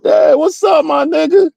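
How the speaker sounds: high-pitched mock holler; called-out greeting. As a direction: imitate someone calling out a greeting. Acted greeting from approaching stranger.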